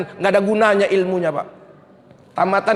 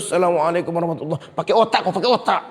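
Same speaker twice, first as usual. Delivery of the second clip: fast, lower, clipped end-of-prayer greeting; father rushing the prayer's end because of his child; rushed salam; 4 semitones lower, faster than usual. Retold or imitated. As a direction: hurry through it irritably. Father ending prayer rushed, annoyed.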